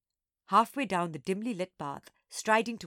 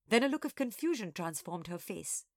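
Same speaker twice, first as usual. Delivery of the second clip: plain reading voice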